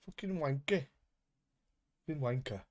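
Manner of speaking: swearing in an attempted Scots accent. Streamer mimicking an insult during the match, accidental Scots accent. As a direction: put on a rough attempt at Scots. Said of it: "put down a fucking Scots accent there".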